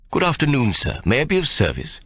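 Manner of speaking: bow and scrape politely; lightly voiced fawning shopman. A narrator's reading.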